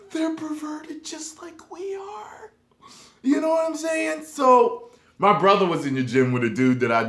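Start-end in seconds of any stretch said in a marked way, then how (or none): 0.1-2.5 high, slow, breathless whispered gasp; mock-horrified high whisper; shocked whisper. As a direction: whisper it in scandalised disbelief. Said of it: "I was like".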